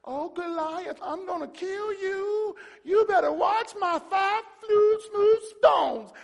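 high, slow, whining fake threat; mock timid. Preacher mocking a timid David facing Goliath, wailing. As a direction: quaver it out like a badly faked warrior. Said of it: "You can't come timid".

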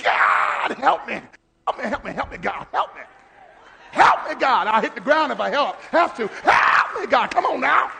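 loud, pleading cry to God; desperate shouted prayer. Retold or imitated. Preacher showing how he prays in pain, desperate shouting. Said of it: "I'm like".

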